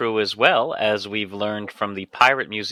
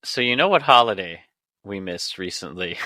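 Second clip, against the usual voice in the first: ordinary talk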